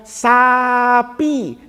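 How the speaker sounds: "sapi" drawn out syllable by syllable, no m; teacher's dictation. Retold or imitated. Teacher dictating to first graders, "sapi" in long clear syllables. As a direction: model "sa-pi" slowly for a pupil. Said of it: "kata gurunya".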